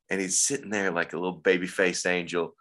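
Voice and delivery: ordinary talk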